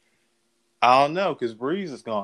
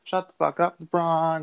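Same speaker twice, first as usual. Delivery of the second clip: mock scream; 6 semitones higher than usual. Retelling a courtside fan screaming at LeBron, high mock yell. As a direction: imitate an irate fan's screaming, held back in volume. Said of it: "started screaming out".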